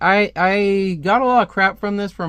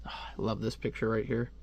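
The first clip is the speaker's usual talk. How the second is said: ordinary delivery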